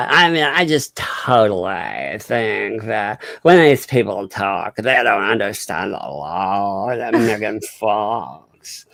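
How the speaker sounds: slow, drawling mock California accent; exaggerated, stretched California accent; awful California accent. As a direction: drag every vowel like a parody Californian. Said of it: "talking with that awful California accent".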